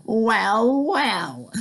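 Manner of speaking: high sweet smiling voice; sweetest smile. A narrator's reading. Wife greeting the giant, sweetly smiling. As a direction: sugar it up for the giant. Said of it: "with her sweetest smile".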